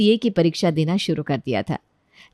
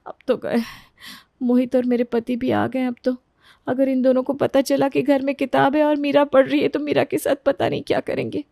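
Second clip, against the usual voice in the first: higher, anxious inner voice; worried daughter-in-law, dreading; 5 semitones higher than usual. Acted. Daughter-in-law dreads husband finding the book, high and worried. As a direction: fret to yourself with dread.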